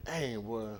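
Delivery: ordinary talk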